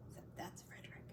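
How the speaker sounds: unvoiced hushed aside; whisper. Real, not acted.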